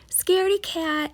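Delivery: mock him like a cheeky kid; taunting. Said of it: "he taunted and teased".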